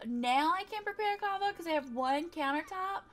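ordinary talk

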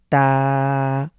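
তা drawn out like Bengali ত with aa; a held, clean তা; demo of ta. Shown as a demonstration. Tutor showing students the letter তা, held. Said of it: "এটাকে আমরা বলবো তা".

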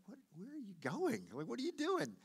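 high-pitched, baffled scolding as God; exasperated, high "what are you doing"; incredulous God. Acted. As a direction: pull someone up short with rising disbelief. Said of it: "it was like God was like".

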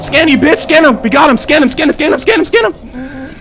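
high, loud rapid chant; frantic excitement. Real, not acted. Gamer urging himself to scan creature, frantic excitement. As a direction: hurry yourself on.

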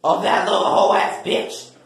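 usual speaking voice

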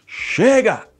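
sharp, high, loud shouted "Chega!". Acted. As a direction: bark "Chega!" to stop them all. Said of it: "Ou você fala somente". (grito bravo "Chega!")

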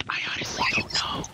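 whispering. Pretend. Whispered reply in a joke.

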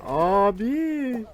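loud, high, strained abi; despairing cry. Real, not acted. Gamer crying out after losing the round, loud and high. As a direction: groan out the word.

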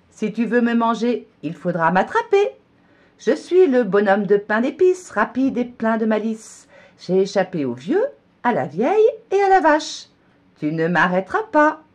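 high, loud taunting rhyme; cheeky gingerbread man. A narrator's reading. Gingerbread man taunting the cow, high and loud. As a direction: boast as you run off. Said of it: "le bonhomme répond".

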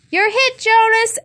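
put-on high, loud boy's yell; excited playground shout. A narrator's reading. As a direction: call it out across the field. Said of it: "Asher yelled from behind the tree".

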